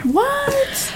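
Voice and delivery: squeaky high disbelieving what; shocked what. Real, not acted. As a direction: yelp it in disbelief. Stunned reaction.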